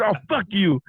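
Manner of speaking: retold indignant swearing; so mad. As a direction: throw it out like an outraged partner. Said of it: "She got so mad".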